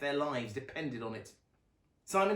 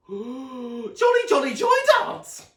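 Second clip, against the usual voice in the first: very high, loud squeal; Sonny squeals for the dance, very high loud squeal; excited squeal; 13 semitones higher, 14 dB louder, slower than usual. A narrator's reading. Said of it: "squealed Sonny excitedly".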